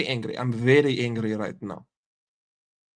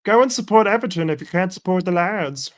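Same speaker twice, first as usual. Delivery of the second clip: higher, sneering put-on voice; mock troll voice; 5 semitones higher, 3 dB louder than usual. Reading out a commenter's insult, lightly mocking. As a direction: spit it like a scornful Liverpool troll.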